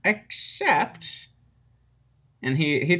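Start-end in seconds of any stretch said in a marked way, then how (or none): 0.0-1.3 put-on high indignant old man; old wizard voice. Wizard answers the party's insult, high indignant old man. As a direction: squeak back at the insult.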